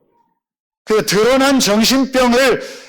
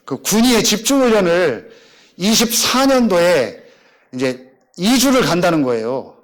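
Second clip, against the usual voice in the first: ordinary talk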